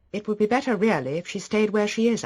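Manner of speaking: high, insistent suggestion; brisk mistress. Acted. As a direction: pitch it high and project to the servant. Mistress giving butler instructions, uneasy.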